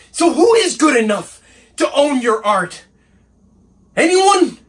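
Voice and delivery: indignant questions climbing to a shout; angry challenge. Acted. Assistant challenging the painter over his art, angry. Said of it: "he's quite angry".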